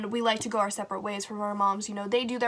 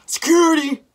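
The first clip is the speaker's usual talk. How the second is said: deep-voiced guard; 6 semitones higher, 10 dB louder than usual. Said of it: "I've never heard a deeper voice".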